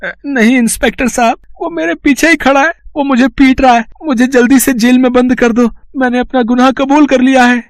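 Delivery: high, breathless panicked pleading; terrified confession. Acted. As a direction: blurt it all out to be saved. Thief begging to be jailed.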